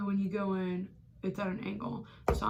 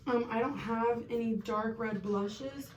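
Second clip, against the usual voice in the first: ordinary delivery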